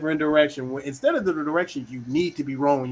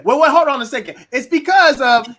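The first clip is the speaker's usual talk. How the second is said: high, loud protest cutting in; defensive interruption; 9 semitones higher, 10 dB louder than usual. Acted. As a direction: jump in to defend yourself, acted out. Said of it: "you really just interrupted the person".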